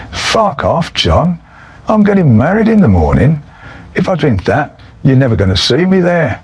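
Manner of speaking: high-pitched mock protest; exasperated protest. Groom refusing twelve champagnes.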